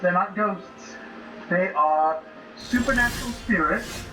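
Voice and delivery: ordinary talk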